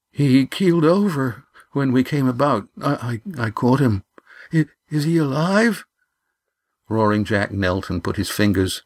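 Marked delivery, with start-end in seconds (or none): 0.1-5.8 higher, halting, worried report; anxious stammer. A narrator's reading. Yan holds fainted Scarm and asks after him, anxious. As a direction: falter on the words, rising to a worried question.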